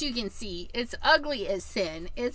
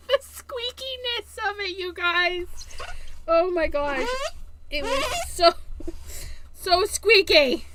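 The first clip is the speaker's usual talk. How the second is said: much higher and louder, laughing through it; amused squeal; 8 semitones higher, 4 dB louder than usual. Real, not acted. Crafter laughing about how squeaky her tool was. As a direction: squeal it out, tickled by the noise.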